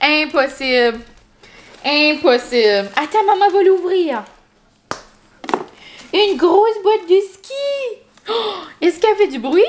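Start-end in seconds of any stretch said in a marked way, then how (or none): 3.0-9.6 high bright dog talk; excited. Real, not acted. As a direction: chatter eagerly to the puppy.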